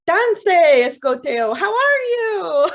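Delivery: high, loud, drawn-out crow call. Acted. Crow greeting the woman who hated it, loud squawky hello.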